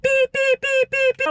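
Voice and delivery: high-pitched repeated beeps; imitated car beep. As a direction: mimic a car horn beeping high. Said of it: "I hear a beep".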